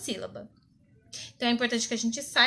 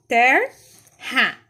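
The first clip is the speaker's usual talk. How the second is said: "terra" as ter - ra, R closing the first beat; loud, slow "terra" in two separate halves; split between the R's; 9 dB louder than usual. Shown as a demonstration. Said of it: "Então, vai ficar assim".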